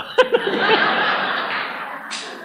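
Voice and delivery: high breathy laugh; a long helpless laugh. Real, not acted. Preacher amused by his own tale, long high laugh.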